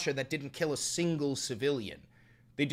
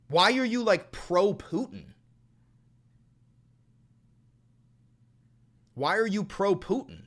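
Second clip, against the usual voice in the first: angry demand; 6 semitones higher, 4 dB louder than usual. Real, not acted.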